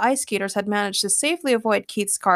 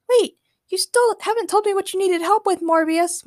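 high questioning voice; Morgana curious; 11 semitones higher than usual. Acted. Morgana asking her cousin, puzzled. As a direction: ask it puzzled.

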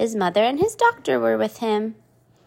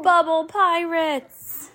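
slow playful correction; 7 semitones higher, much slower than usual. Shown as a demonstration. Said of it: "now we're gonna go on to Bubble Guppies".

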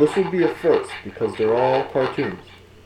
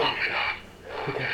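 inside a rubber mask; 8 dB quieter than usual. Pretend. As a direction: exhale it through the mask. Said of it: "It's getting hot in this mask".

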